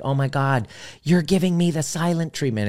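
high, whiny accusing partner; hurt accusation. Retold or imitated. Partner accusing the other of the silent treatment, high and whiny. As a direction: complain like a hurt partner who feels shut out. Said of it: "And it's like".

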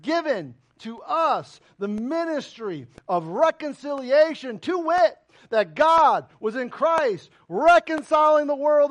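slow, solemn reading of scripture from the pulpit. Acted. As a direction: lay each phrase down slowly and weightily. Reads scripture aloud.

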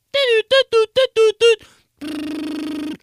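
high, loud doot doot like a ringing phone; phone ring imitation. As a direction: chirp the ringtone up high.

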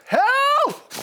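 high hollered one-word prayer; imitated cry for help. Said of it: "there's one prayer I pray".

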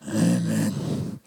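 low mock-reluctant grumble; preacher hamming up the grumbled amen for the introverts. Shown as a demonstration. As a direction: mutter it like a grudging introvert. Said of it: "Can I get a grumbled amen".

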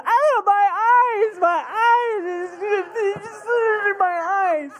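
very loud, high, drawn-out mock wail; wailing child. Little brother on the bottom bunk hit by vomit, comic wailing. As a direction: howl in hammed-up agony, dragging out the words. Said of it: "and he's going".

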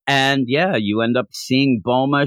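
normal talking voice

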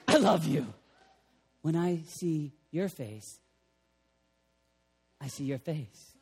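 very soft and low, gentle; tender father voice. Acted. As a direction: speak like a loving father.

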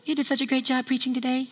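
high, soft, quick compliment; flattering admirer. Acted. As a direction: coo the praise softly, up high. Acted admirer flattering preacher.

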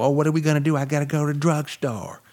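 high, fretful mock panic. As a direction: fuss like a nervous local over snow. Mocking Southerners panicking over snow.

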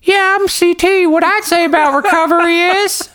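high squeaky puppet voice. Pretend. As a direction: talk like a hand puppet. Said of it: "with a puppet voice".

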